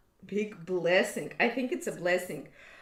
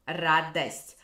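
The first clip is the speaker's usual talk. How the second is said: радость with an A sound after the Д; Moscow Russian; 3 semitones lower, 4 dB louder than usual. Shown as a demonstration. Teacher repeating the Moscow pronunciation of радость. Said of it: "A after D".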